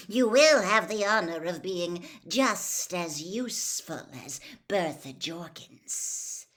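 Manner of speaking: lightly voiced cold, commanding lord; calm menace handing out orders; a dark lord instructing his servant; cold master. A narrator's reading. The cold lord toys with Wormtail.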